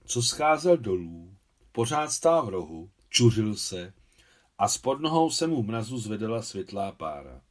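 ordinary delivery

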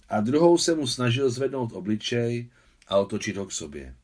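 ordinary delivery

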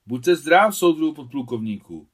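high, loud eager greeting; a boy greeting the lieutenant colonel with a loud shout; loud boyish shout. A narrator's reading. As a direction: shout it out like a boy saluting an officer. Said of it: "vykřikl hlasitě".

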